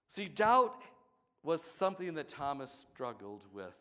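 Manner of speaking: normal talking voice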